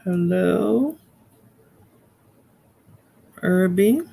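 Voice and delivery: drawn-out hello with rising end; calling out. Real, not acted. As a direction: call to someone who may have dropped off. Calling to a caller gone silent.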